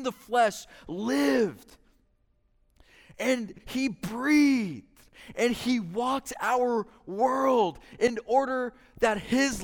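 loud, driving pulpit delivery; fervent preaching. Acted. Preacher urging the congregation, loud and fervent. Preaching at full force on the incarnation.

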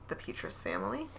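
soft secretive whisper. Real, not acted. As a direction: drop to a hushed whisper. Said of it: "Why are we whispering?".

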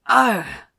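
sudden loud yelp; sharp pained cry. A narrator's reading. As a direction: cry out at the sting. Hot wax drop hits skin.

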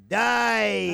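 Dai stretched long and high; drawn-out dai. Shown as a demonstration. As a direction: draw out the Dai, rising high. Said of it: "they draw it out".

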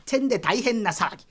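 ordinary delivery

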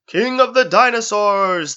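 high, loud, slow arena announcer boom. Acted. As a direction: stretch it out for the crowd. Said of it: "the announcer was saying".

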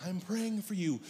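quiet, controlled sweetness; polite concern. Acted. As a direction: murmur it as restrained pastoral concern. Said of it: "send her a message and say".

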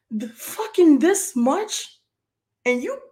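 high, loud, drawn-out disbelief; indignant and incredulous, pitch jumping up. Real, not acted. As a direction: stretch it into an outraged question. Disbelief at the tiny shortfall.

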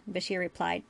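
ordinary delivery

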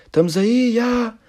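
loud, high shouted reply. Retold or imitated. Answering the comedian from the crowd, shouting loudly. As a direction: yell it back to the stage. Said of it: "e eu disse".

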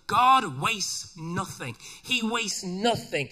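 slow, drawn-out preaching emphasis; slow preached emphasis. Acted. Preacher declares God wastes nothing, slow and emphatic. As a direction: land each word slowly.

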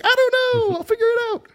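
put-on high, goofy, careless drunk voice; drunk carefree self. Drunk John telling friends he'll manage, high and goofy. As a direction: shrug it off like a happy drunk.

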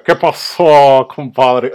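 slow, loud hearty "compadre" reply; buddy reply. Retold or imitated. Retelling how Perro Bermúdez answered everyone, mock hearty greeting. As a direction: imitate a celebrity's hearty greeting. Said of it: "siempre respondía".

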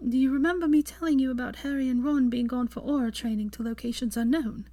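Hermione. A narrator's reading. Hermione explains.

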